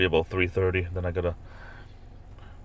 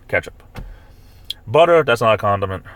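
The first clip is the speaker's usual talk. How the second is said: ordinary talk, nothing marked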